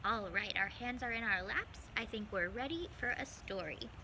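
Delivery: ordinary delivery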